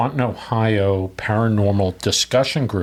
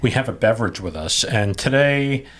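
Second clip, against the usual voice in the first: ordinary talk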